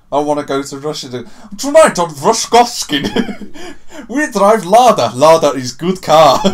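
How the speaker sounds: put-on slow, high, loud Russian accent. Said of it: "my Russian accent".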